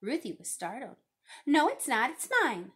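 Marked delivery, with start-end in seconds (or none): 1.5-2.7 loud, insistent denial; acted playground squabble; childish arguing. A narrator's reading. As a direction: argue back like a possessive child. Children quarrel over a camera.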